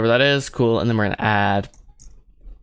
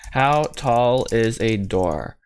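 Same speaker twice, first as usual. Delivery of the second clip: HOW TALL IS A DOOR drawn out word by word; slow, spelled-out delivery of "How tall is a door"; slow, deliberate; much slower than usual. Real, not acted. Repeats question slowly while searching.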